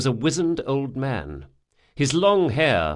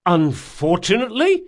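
higher, louder incredulous question; outraged disbelief; 9 semitones higher, 6 dB louder than usual. Acted. Intruder throws back the old man's word, outraged. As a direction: push UNFORTUNATELY up high and loud in outrage. Repeats the echo, more outraged.

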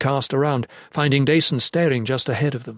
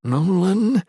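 raised, strained control; barely controlled voice; 7 semitones higher, 3 dB louder than usual. A narrator's reading. Leader addressing panicking Nolan, voice barely controlled. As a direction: hold down the alarm, pitch climbing. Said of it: "he said, voice barely controlled".

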